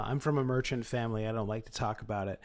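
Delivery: ordinary talk, nothing marked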